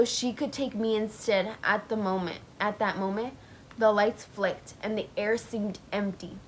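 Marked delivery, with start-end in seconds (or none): none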